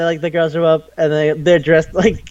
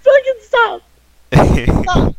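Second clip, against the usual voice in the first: outraged yell; 11 semitones higher than usual. Real, not acted. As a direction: yell at the screen to make it stop. Mock outrage at the show.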